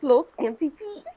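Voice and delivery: repeated slowly and clearly for someone who missed it. Real, not acted. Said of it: "What it is?".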